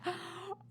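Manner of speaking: sharp intake of surprise; real surprised gasp; astonished gasp. Real, not acted. Astonished at the number of cats.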